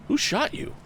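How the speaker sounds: sharp, high alarmed call; cried out. A narrator's reading. Tom reaching the wounded man, crying out high. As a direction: call out in shock. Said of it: "cried Tom".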